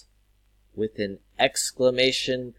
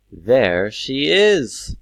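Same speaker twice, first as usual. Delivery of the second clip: stretched out, raised and loud; slow exclaimed example; 4 semitones higher, 10 dB louder, much slower than usual. Shown as a demonstration. Teacher reads another example, slowly exclaimed. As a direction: draw the words out slowly and loudly. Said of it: "It's an exclamation".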